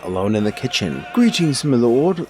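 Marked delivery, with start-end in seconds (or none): 1.1-2.2 high, loud cook in a hammed-up accent; put-on servant's accent, pitched up and loud; cook's accented voice. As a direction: greet the lord as an excitable cook. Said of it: "I don't know what accent that was turning into".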